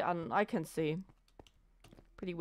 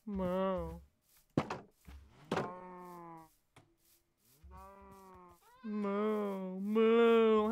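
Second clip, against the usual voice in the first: mooing complaint; much slower than usual. Acted. Player imitates herself complaining, mock cow moo. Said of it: "That's me when I complain".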